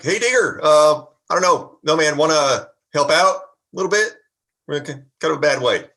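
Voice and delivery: loud, high call for help; yelling out. Acted. Player as Cletus calls to Digger for help in a fight, yelling out. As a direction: holler across to a friend, stumbling through the plea. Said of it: "yell out like".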